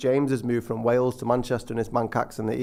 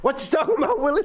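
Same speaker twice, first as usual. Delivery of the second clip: put-on much higher, squeaky kid catchphrase voice; Different Strokes catchphrase; 12 semitones higher, faster than usual. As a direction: pitch it up like the sitcom kid. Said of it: "Willis out of, uh, Different Strokes there".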